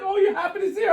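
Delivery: high words through laughter; laughing. Retold or imitated. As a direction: giggle through the quote. Retold question, laughing.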